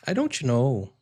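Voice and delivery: Brazilian-accented English, "don't" said as "don-chi". Shown as a demonstration. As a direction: imitate a Brazilian student, T turned to "chi". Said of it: "the T is like a chi sound".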